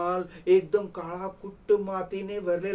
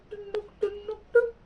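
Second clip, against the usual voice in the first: squeaky hop sound; faster than usual. Acted. Mouse hops off through village.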